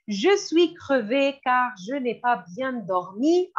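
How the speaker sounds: ordinary delivery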